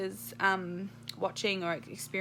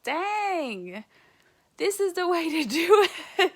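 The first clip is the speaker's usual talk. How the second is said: higher, louder gleeful cheer; thrilled, louder and higher-pitched exclamation; delighted exclaim; 9 semitones higher, 8 dB louder, slower than usual. Retold or imitated. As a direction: crow about how easy it was. Delighted at quick birth.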